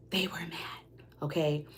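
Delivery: a confiding whisper. Real, not acted. Reader revealing the hidden truth, in a confiding whisper. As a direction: let the reveal drop to a whisper.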